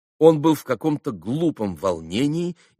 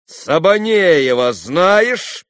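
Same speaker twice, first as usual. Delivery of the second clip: loud, higher, very slow demand; stern insistence; 8 semitones higher, 8 dB louder, much slower than usual. A narrator's reading. Boy cross-examining the lad, sternly insistent. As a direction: stretch the question out, rising sternly at the end. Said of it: "ещё настойчивее, ещё строже продолжал".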